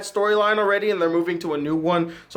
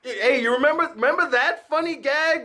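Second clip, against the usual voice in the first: usual speaking voice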